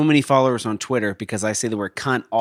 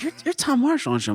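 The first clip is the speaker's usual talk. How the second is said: higher, eager stranger asking; pitched-up curious stranger; 11 semitones higher, 4 dB quieter than usual. Retold or imitated. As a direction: ask like an excited stranger. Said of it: "They're like".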